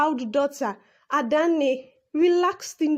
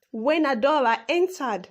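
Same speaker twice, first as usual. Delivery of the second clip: ordinary delivery, nothing marked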